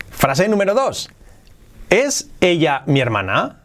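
usual speaking voice

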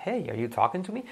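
"hey" lifted high, "talking to me" kept high; question intonation. Shown as a demonstration. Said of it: "hey para la pregunta".

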